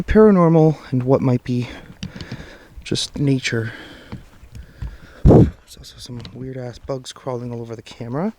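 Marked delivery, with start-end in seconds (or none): none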